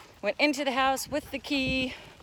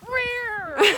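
mock roar; 11 semitones higher, 6 dB louder than usual. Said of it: "Wait, I have a joke".

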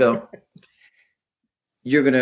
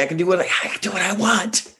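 playful freedom; 8 semitones higher, faster than usual. Real, not acted. Kids gone, playful freedom.

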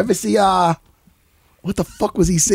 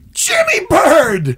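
excited greeting; 12 semitones higher, 3 dB louder, much slower than usual. Real, not acted.

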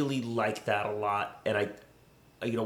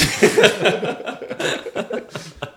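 loud laugh; 8 semitones higher, 6 dB louder than usual. Real, not acted. Laugh after villain line.

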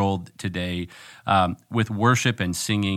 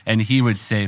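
ordinary delivery

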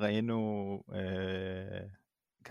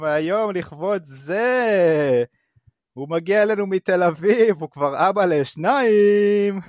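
loud, higher, building to the name; announcer intro; 7 semitones higher, 9 dB louder, much slower than usual. Acted. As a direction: draw out the name like a ring announcer. Host introduces second guest.

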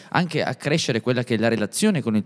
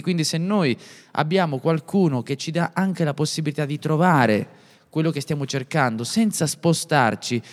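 ordinary talk

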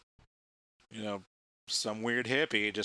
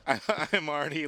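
laughing; 5 semitones higher, 7 dB louder, slower than usual. Real, not acted.

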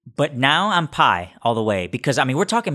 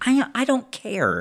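high mock reply; 10 semitones higher, 4 dB quieter, slower than usual. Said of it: "I'm like".

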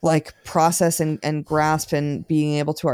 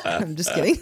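laughing; 12 semitones higher than usual. Real, not acted. Laughing at a sleepy goodbye.

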